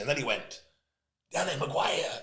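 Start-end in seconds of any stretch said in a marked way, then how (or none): 1.3-2.2 rough imitation of an American accent. American greets rugby player in the box, light American accent. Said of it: "I'm doing an American accent".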